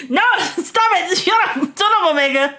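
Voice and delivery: high, squealing mock outrage; fake-offended squeal at a teasing friend; playful mock protest.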